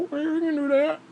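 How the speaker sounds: high mocking agreeable voice; mock Dale T. Dale T agreeing to be paid later, high squeaky mockery. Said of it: "You know".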